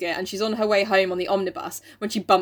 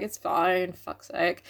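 grudging resignation; 2 semitones lower, 5 dB quieter, much slower than usual. Acted. Grudging acceptance, acted.

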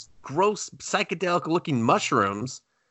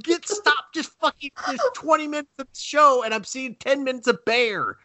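exasperated pleading; 12 semitones higher than usual. Retold or imitated. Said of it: "I was like".

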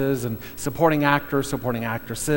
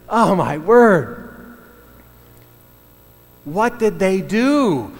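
slow, drawn-out, louder gasp of disbelief; stunned shock; 5 semitones higher, 5 dB louder, much slower than usual. Retold or imitated. Family after the killer jumps from the lake, stunned and slow. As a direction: stretch it out as if reeling from a fright. Family frightened by horror film ending.

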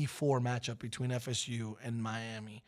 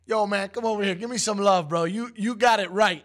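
higher and louder, a big hearty hello; loud friendly greeting; 8 semitones higher, 10 dB louder than usual. Retold or imitated. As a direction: call him over like an old buddy. Warren Sapp greets him loudly.

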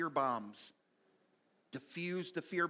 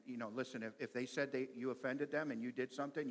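usual speaking voice

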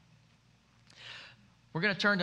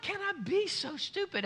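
high, frustrated self-scolding; mock beating yourself up; lightly voiced exasperation at yourself; self-reproach; 11 semitones higher, 4 dB quieter than usual.

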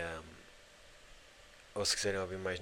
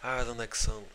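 put-on loud, higher Angolan-accented Portuguese; 3 semitones higher, 6 dB louder than usual. A street seller asking tourists where they're from, in a mock Angolan accent. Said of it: "a falar com aquele sotaque".

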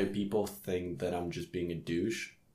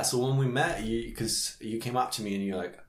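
ordinary talk, nothing marked

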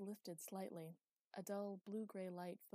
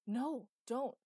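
much louder, higher warning cry; alarmed shout; 6 semitones higher, 13 dB louder than usual. A narrator's reading. Thunder to Magda as she picks up the candle, shouted warning. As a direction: shout it out to stop her. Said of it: "shouted Thunder".

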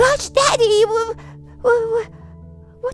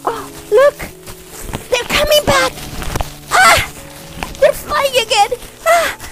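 high-pitched gasps of wonder; amazed children; 11 semitones higher than usual. Acted. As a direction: marvel in a high, excited voice. Children see toys flying again.

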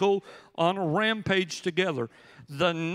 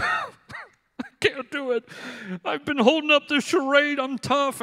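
mock breakdown; 6 semitones higher than usual. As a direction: let the act finally crack. Collapsing at home, breaking down.

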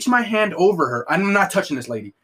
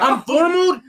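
much higher, louder disbelieving shout; shocked outcry; 5 dB louder than usual. Retold or imitated. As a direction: yell it in stunned disbelief. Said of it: "I'm like".

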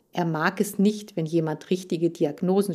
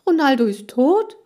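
high, loud shocked exclamation; horrified cry; 7 semitones higher, 9 dB louder than usual. Acted. Heiko horrified at hearing the dog might be dead, crying out. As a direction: cry out in horror. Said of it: "rief Heiko entsetzt".

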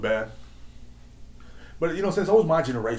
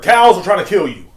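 loud, raised-pitch proclamation; mock alarm slogan; 4 semitones higher, 10 dB louder than usual. Podcaster wanting the joke on a shirt, loud mock alarm. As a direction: shout it like a ridiculous catchphrase.

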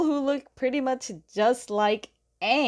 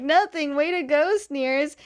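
high-pitched, hasty mock praise; retold startled backpedal, pitched up; shocked congratulation; 6 semitones higher than usual. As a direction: cover the shock with a higher-voiced cheer. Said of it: "the reason why he's so shocked".

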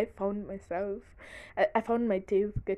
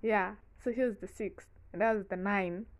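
ordinary talk

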